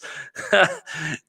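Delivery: short rueful laugh at his own losses. Real, not acted. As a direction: laugh it off, a little pained.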